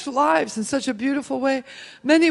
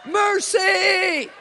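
high, loud cry of "Mercy!"; 7 semitones higher, 6 dB louder than usual. Retold or imitated. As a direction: let it burst out as you fall. Falling down under God's power.

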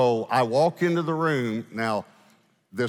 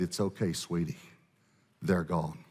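soft, low, gentle comforting; tender reassurance; 5 semitones lower, 6 dB quieter than usual. Retold or imitated. Elderly lady comforts the freed woman, softly and tenderly. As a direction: soothe her gently, voice dropped low.

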